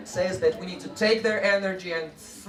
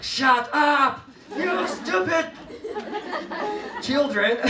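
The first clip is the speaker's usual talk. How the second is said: angry yell; 9 semitones higher, slower than usual. Shown as a demonstration. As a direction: yell at the kids in mock anger. Teacher demo of yelling at class.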